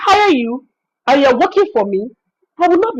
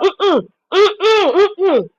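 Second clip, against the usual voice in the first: mocking hum; 7 semitones higher, much slower than usual. Mocking the client's reaction.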